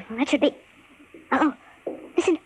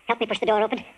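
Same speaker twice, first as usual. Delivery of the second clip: normal talking voice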